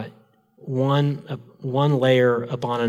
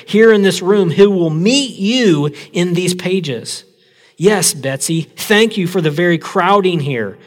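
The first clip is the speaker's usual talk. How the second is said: lively, heightened reading of a memoir; dramatic story reading; 3 semitones higher, 4 dB louder than usual. A narrator's reading. Pastor reading a prison camp story to church, heightened and lively. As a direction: read it aloud to the congregation with feeling. Pastor reads book passage aloud.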